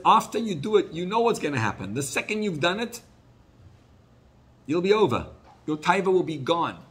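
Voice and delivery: high, loud urgent exhortation; wife urging Nasan to conquer temptation; impassioned plea. Retold or imitated. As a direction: plead with him to resist.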